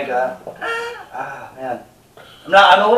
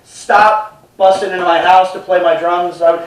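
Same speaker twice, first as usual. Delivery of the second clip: ordinary talk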